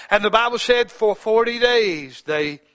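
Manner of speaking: high, loud preacher's proclamation; preaching at full force. Acted. Preacher proclaiming scripture to his flock, loud. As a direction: declare it to the pews.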